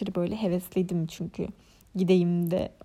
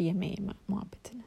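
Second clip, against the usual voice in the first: whisper; 7 dB quieter, faster than usual. Real, not acted. Trailing dismissive aside.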